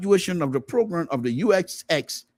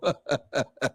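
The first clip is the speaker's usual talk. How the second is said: the laugh dies down, softer; fading laugh; 6 semitones lower, 4 dB quieter than usual. Real, not acted. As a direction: let the laugh trail away. Laughter trailing off after outburst.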